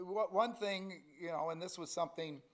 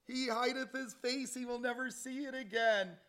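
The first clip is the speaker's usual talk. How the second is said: full-force preaching; 7 semitones higher, 8 dB louder than usual. Acted. Preacher thundering the wicked man's words at the congregation.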